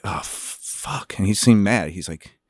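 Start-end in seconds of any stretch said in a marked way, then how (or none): 0.0-1.1 hushed, breathless whisper of dread; whispered curse. Retold or imitated. Actor whispering in fear as the director calls him over. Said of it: "I was like".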